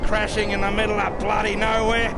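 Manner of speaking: very high, loud boozy holler; loud merry call from far away; tipsy calling out. Acted. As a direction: shout it back across the distance, half drunk.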